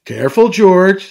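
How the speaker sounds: father calling out a caution; Daddy Pig's warning. A narrator's reading. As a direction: warn the little one out loud. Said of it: "says Daddy Pig".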